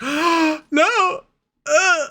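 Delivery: very high squeal of disbelief; mock devastated. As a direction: imitate heartbroken shock in a tiny high voice. Retold shock at handed keys.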